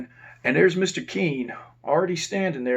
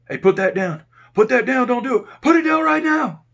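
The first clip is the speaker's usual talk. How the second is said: rising, much higher and louder barked orders; officer shouting at an inmate to drop it, louder and louder; yelling commands; 11 semitones higher, 9 dB louder than usual. Retold or imitated. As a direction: order him down, getting louder each time. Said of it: "That's Mister Keene yelling".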